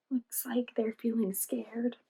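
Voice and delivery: slow hushed whisper; soft slow whisper. Acted. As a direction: whisper it like a secret. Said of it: "narrator whispers to children".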